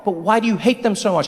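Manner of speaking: ordinary talk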